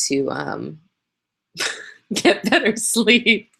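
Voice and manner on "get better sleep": laughing. Real, not acted. Laughing at own exhaustion.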